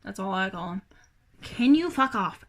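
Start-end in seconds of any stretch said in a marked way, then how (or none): 1.4-2.4 loud, high, drawn-out complaint; real exasperated scolding. Real, not acted. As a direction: scold the pet that won't back off. Scolding the pet that popped up.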